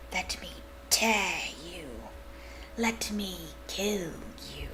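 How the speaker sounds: slow, icy, unvoiced whisper; chilling whisper. A hidden monster calling to Harry, icy slow whisper. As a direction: breathe it like venom in the dark. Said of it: "a voice to chill the bone marrow".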